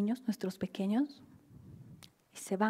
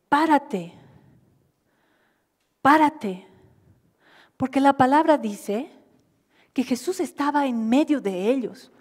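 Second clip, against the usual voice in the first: loud, higher, impassioned preaching; preaching at full force; 4 semitones higher, 9 dB louder than usual. Acted. Pastor urging the congregation to stand, preaching loudly.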